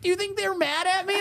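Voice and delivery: very high, softened mock-anxious question; imitating worried partner. Worried partner voiced in a story.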